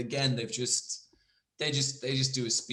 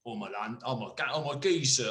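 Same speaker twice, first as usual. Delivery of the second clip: put-on rough try at English geezer accent; lightly mocked English geezer accent; badly faked accent. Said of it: "doing one accent badly".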